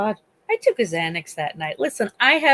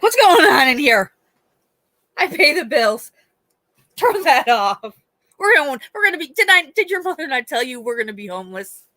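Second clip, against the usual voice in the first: grumpy deaf dad; 5 semitones higher than usual. Retold or imitated. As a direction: bluster like a confused father who pays the bills. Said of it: "be like".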